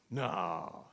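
low, soft, disbelieving no; sceptical refusal. Acted. Zacharias doubting the angel's news, sceptical. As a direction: mutter it in doubt.

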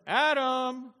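high, drawn-out loud call. Acted. God calls to Adam in the garden, calling out. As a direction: call out for someone hiding.

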